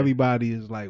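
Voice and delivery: ordinary delivery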